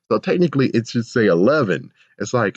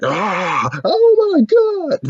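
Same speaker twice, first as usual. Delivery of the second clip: squeaky panic; 6 dB louder, much slower than usual. Pretend. Imagined scared reaction.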